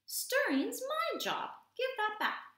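very high, loud, slow squeak; squeaking squirrel. Acted. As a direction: demand it back as an indignant squirrel. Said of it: "squeaked the squirrel".

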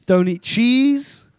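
usual speaking voice

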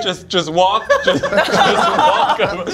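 words breaking into real laughter; breathless laughing repeat of the instruction. Real, not acted. Retelling the director's call to Maria, breaking into laughter.

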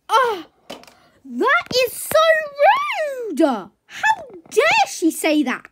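loud, high, hammed-up indignation; shrieky offended outburst; outraged. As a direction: cry out, scandalised by the insult. Insulted horse explodes in outrage.

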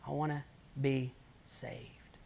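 hushed, slow whisper; whispered plea. Retold or imitated. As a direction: breathe it out as a broken plea. Weeping girl pleads to be saved.

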